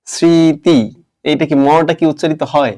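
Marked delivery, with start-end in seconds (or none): 0.1-0.8 স্মৃতি said as srriti, the ম silent; teacher models স্মৃতি with no m sound; demo pronunciation. Shown as a demonstration. Teacher shows the class how স্মৃতি really sounds. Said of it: "স এর সাথে ম ফলা আছে".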